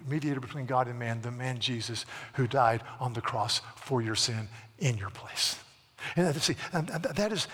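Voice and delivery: ordinary talk, nothing marked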